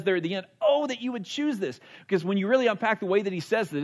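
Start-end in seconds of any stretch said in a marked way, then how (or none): 0.6-1.8 high, fervent plea from the heart; with conviction. Retold or imitated. Moses pleading with Israel at the end, with conviction. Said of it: "say this with such conviction".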